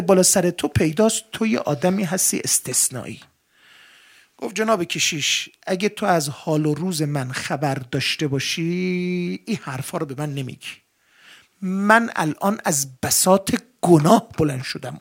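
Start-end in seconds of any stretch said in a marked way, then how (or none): none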